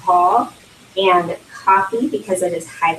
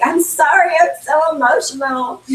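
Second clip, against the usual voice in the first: high, louder imitation of her teary self; mock tearful apology; 9 semitones higher, 3 dB louder than usual. To friends mocking her, a hammed-up tearful apology. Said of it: "I'm like".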